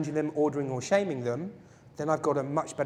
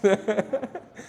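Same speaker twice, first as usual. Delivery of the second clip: quick bright laugh; short laugh; 5 semitones higher than usual. Real, not acted. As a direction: laugh it off lightly. Laughs at own joke.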